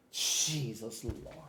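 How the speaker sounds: exasperated sigh. Real, not acted. Exasperated after the call.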